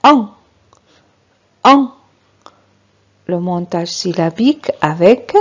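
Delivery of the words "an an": "an" as one nasal vowel, no N heard; nasal vowel sound. Shown as a demonstration. Teacher shows what A N gives, "an" as a pure nasal vowel. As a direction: say "an" through the nose without closing on N. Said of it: "A N qui donne".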